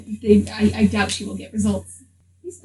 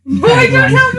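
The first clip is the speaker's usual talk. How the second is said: much higher, louder, laughing mimicry; squeaky mock repeat; 14 semitones higher, 7 dB louder than usual. Player teasing a friend by parroting the line, squeaky and laughing.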